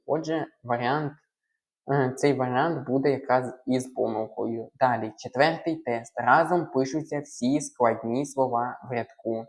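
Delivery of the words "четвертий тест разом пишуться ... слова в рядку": ordinary talk, nothing marked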